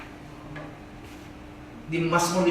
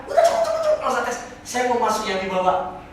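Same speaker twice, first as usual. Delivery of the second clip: eager demand; 5 semitones higher, 4 dB louder than usual. Acted. Man demands hell.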